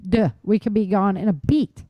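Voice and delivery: ordinary talk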